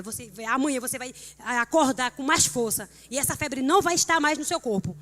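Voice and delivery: loud, stern mother ordering her son to stop crying; forceful command. Retold or imitated. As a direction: rouse him like a mother who won't take no.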